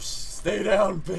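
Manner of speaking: tough-guy threat. Pretend. Voicing the character's violence.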